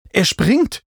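high, loud exclamation; alarmed cry. A narrator's reading. Narrator calls out as the climber jumps, alarmed cry. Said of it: "rief ich".

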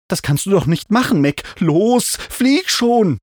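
high, agitated protest building to a shout; urgent pleading. A narrator's reading. Narrator begs the refusing mount to fly, urgent.

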